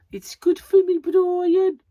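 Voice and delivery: very high, loud, over-the-top Brummie; hammed-up Birmingham squawk; Birmingham accent. Shown as a demonstration. Vlogger repeating her accent slip for laughs, exaggerated Birmingham accent. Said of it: "in that accent".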